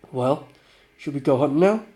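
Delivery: ordinary talk, nothing marked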